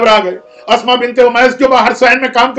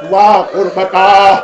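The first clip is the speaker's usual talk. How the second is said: drawn-out lament; 3 semitones lower, much slower than usual. Acted. Husain's lament over his mother.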